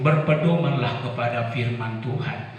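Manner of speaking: ordinary talk, nothing marked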